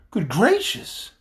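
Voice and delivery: high, loud, slow gasp of shock; Dorothy's cry. A narrator's reading. Dorothy, shocked at the chief's news, cries out high and loud. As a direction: cry out in startled dismay. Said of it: "cried Dorothy".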